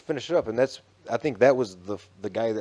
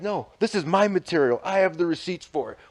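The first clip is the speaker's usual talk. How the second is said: higher-pitched, defiant mock protest; retold argument; 7 semitones higher than usual. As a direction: imitate a contractor standing his ground.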